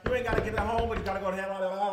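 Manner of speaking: tongue-tied, words tripping over each other; stumbling through the catchphrase with mouth full; fumbled catchphrase. Real, not acted. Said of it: "I can't even speak right now".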